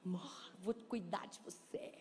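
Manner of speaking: soft, slow loving words to his wife; a lightly voiced sweet husband; tender husband. Pastor voicing a husband murmuring to his wife, soft. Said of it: "olhou pra esposa e deu beijinho".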